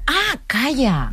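high, drawn-out exclamation of remembering; sudden realisation. Real, not acted. Guest suddenly recalling the Camisa Negra story, surprised. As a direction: stretch it out as it comes back to you.

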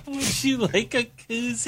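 very high, slow mock whimper; mock crying. Co-host mimics crying bartender's koozie offer, whimpering. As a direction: squeak it out as if in tears.

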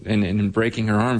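usual speaking voice

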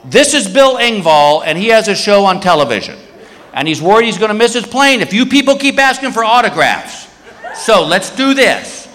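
mock public announcement; announcing to the line. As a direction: address a whole security line. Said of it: "he goes to this whole security line".

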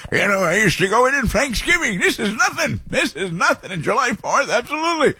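high, put-on old geezer voice; mock old-man boasting in a high voice; old guy. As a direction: brag like a cranky old-timer. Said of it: "I'm like the old guy".

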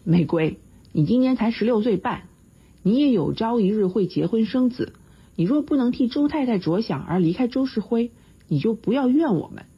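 a mild touch of flustered scolding; lightly angry, red-faced lecture; embarrassed anger. A narrator's reading. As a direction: scold her, stung and a bit ashamed. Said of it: "我恼羞地说道".

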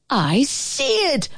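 high, drawn-out cry without much volume; mild yell. A narrator's reading. Brother spots the monster in the pit, high mild yell. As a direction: stretch it out high, as a restrained yell. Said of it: "my brother yelled".

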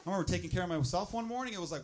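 normal talking voice

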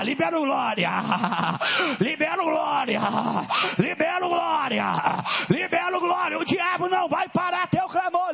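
fiery preacher rallying the church; full-force preaching. Acted. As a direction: whip the congregation into praise.

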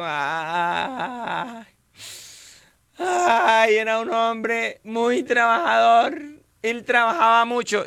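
high, lamenting mock widow, drawn out; mourning widow. Widow praising her dead husband at the funeral, mock lament. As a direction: wail the praises like a hammed-up grieving wife.

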